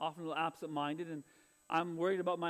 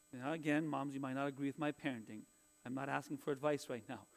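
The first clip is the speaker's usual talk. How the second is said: usual speaking voice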